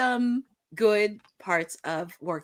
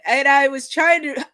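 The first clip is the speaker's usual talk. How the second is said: normal talking voice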